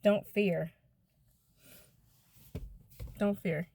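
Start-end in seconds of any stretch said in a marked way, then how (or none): none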